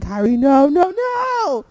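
loud panicked yell of no; screaming warning at the wrestler on screen. Retold or imitated. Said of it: "Like, I'm screaming".

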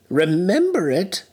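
put-on high, eager old miser; fervent Scrooge. A narrator's reading. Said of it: "cried Scrooge with fervor".

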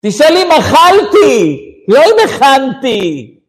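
hammed-up high, loud wife shouting; an exasperated wife exploding, imitated; wife's outburst. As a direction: scream at the husband in mock outrage. Wife's angry reply to husband.

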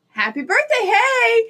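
put-on cheery greeting; fake-casual cheer. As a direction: cover up nerves with a loud, lifted hello. Acted cheery greeting to mom.

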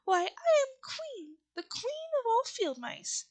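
high, slow, haughty mouse queen; indignant. A narrator's reading. Mouse queen corrects the Woodman about her rank, slow indignant squeak. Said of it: "cried the little animal indignantly".

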